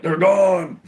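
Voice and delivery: put-on high, loud shout of an old bum; old bum calling out. A bum shouting that the cops left. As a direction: call out the all-clear. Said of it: "some old bum be like".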